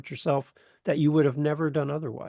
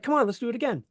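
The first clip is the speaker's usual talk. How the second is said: eager coaxing; 9 semitones higher, faster than usual. Retold or imitated. Mentor eager for more.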